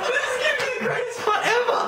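high, fast giggly excitement; breathless with giggles; laughing. Real, not acted. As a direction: giggle it out, thrilled. Laughing giddy commentary.

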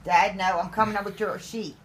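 normal talking voice

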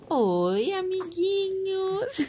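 put-on high drawn-out baby voice; cartoon princess. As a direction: voice the baby greeting her fans. Mãe falando pela bebê. (voz fininha de bebê)

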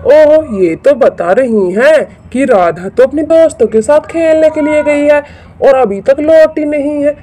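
ordinary talk, nothing marked

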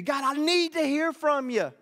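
loud, high plea to God; crying out. Acted. Believer crying out to God about an issue, high and loud. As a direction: cry it out, desperate to be heard. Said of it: "you've been crying out to God".

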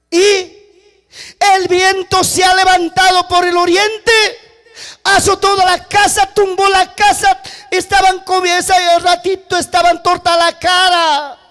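high, urgent disaster report; messenger's alarmed cry. Acted. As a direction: wail out the news, voice held high. Last messenger reports children's deaths. (relato a gritos, agudo y alarmado)

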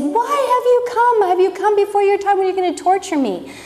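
high, rushed retold outcry; mock scream. Demoniac confronts Jesus on falling down, mild mock scream. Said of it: "he fell down and screamed".

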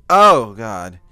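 sudden loud hiccup yelp; jolted by a hiccup. Real, not acted. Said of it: "how hard these hiccups are".

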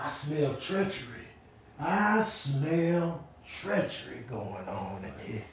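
soft, slow, menacing murmur; a quiet, slow, ominous cat voice; sinister cat. Acted. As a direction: sniff out treachery. Voicing a suspicious cat.

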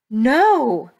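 loud, high cry of protest; alarmed shout. A narrator's reading. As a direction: shout it out to stop disaster. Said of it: "Ivy shouted".

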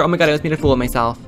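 ordinary talk, nothing marked

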